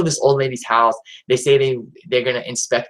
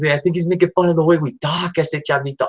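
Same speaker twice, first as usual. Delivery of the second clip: lightly put-on Chicano accent, pitched higher; a hint of Chavo's Chicano accent, higher; imitating Chavo's accent; 4 semitones higher than usual.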